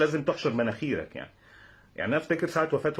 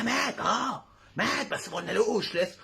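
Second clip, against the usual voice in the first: high put-on hissing voice; high serpent-like aside; snake hiss; 9 semitones higher, slower than usual. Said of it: "نفس بفحيح الأفعى كده".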